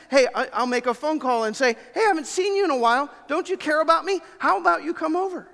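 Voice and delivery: high, pleading, clingy voice; needy caller. Acted. Preacher mimics a needy caller begging a friend to visit. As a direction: play the lonely friend begging for attention.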